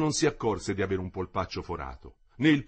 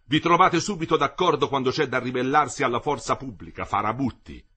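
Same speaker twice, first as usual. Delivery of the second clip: angry rebuke; 4 semitones higher, 5 dB louder than usual. A narrator's reading. Delegate berates the rebellious workers.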